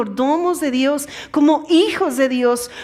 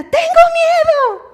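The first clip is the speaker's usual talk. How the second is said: much higher, louder, drawn-out shriek; scared child's scream; 7 dB louder, slower than usual. Retold or imitated. Girl stuck in the play tunnel, screaming in fear. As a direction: shriek it like a frightened little girl. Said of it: "un grito espeluznante".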